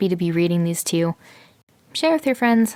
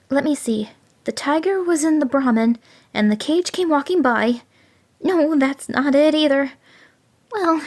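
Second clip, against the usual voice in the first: higher jackal faking a tremble; mock fright; 8 semitones higher than usual. A narrator's reading. Jackal fakes terror to muddle the tiger, higher. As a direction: pretend to be flustered and scared. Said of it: "pretending to tremble with fright".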